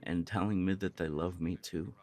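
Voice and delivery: ordinary delivery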